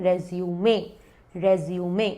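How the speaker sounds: रेज्यूमे ending in "may"; low, measured रेज्यूमे, last vowel sounded; French-derived pronunciation. Shown as a demonstration. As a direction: say रेज्यूमे as "rez-yoo-may". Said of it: "इसीलिए इसका pronunciation है रेज्यूमे".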